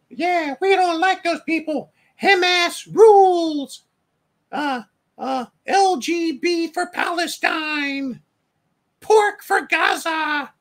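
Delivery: high, hammed-up mocking protester voice. Mocking hecklers in a high put-on voice. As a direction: sneer it out like a shrill caricature.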